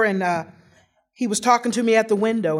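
normal talking voice

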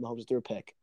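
dropped to a hush; stunned, quiet. Real, not acted. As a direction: let it sink in, softly. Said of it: "Oh my God".

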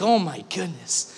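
stunned psalmist, quietly amazed; jaw-dropped awe. Retold or imitated. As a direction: breathe it out, stunned by God. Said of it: "jaw dropped".

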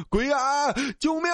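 a driver fleeing a ghost, shrieking; panicked shout. Acted. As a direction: scream it out in terror. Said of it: "我大喊道".

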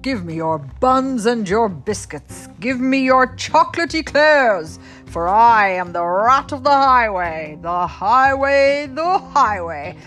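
loud, high bellowing highwayman; thundering rat. Acted. Mother voicing the rat thundering at the squirrel, loud. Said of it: "who thundered".

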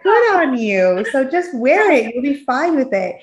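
high, gushing reassurance, loud on the praise; retold mom's high-pitched persuasion; coaxing mother. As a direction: talk a child into it, stretching the praise. Mother retells coaxing daughter into jacket.

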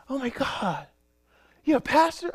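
high-pitched mock amazement; astonished disbelief. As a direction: imitate a stunned young lady. Imitating the astonished young lady.

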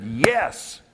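a booming, firm yes from the Lord; loud, strong voice of God. Retold or imitated. The Lord grips him, answers with a loud firm yes. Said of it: "It was so loud and so strong".

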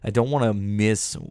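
ordinary talk

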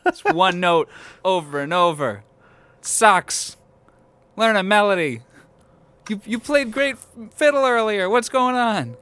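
high, loud exasperated shouts; mock outraged heckling. Host heckles the bell as bad music, loud mock outrage. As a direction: yell complaints like a disgruntled audience member.

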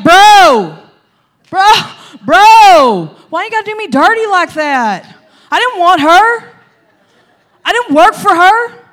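loud, high, whiny bro protest; outraged complaint. Retold or imitated. Jacob cries out to Laban over the switched bride, loud and whiny. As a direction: wail at him for the dirty trick. Jacob angry at being tricked.

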